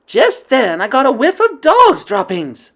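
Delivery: high, loud alarmed report; excited witch. Acted. Witch warning the coven, loud and alarmed. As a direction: warn the others urgently.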